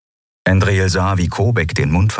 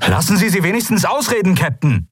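high, fast call; 12 semitones higher than usual. A narrator's reading. Sephiron calls after the leaving captain, high and fast. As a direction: shout after the captain to wait. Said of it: "rief Sephiron".

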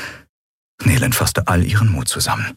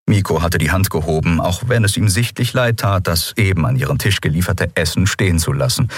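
plain reading voice

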